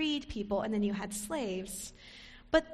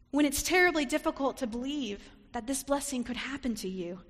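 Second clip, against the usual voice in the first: normal talking voice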